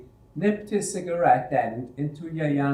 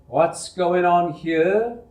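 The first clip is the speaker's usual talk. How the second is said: slow, louder, higher angry demand; wrathful demand; 5 semitones higher, 7 dB louder, much slower than usual. Acted. Foreman bursting into the shop, wrathful slow demand. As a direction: demand an answer from the room. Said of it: "Liu demanded wrathfully".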